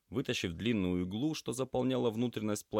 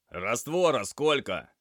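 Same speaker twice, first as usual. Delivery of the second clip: loud, higher, drawn-out call; shouted question; 5 semitones higher, 8 dB louder, slower than usual. A narrator's reading. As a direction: shout to the doctor for instructions. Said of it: "крикнул Лёша".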